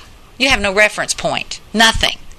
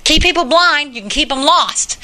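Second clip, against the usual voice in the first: ordinary talk, nothing marked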